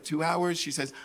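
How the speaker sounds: plain reading voice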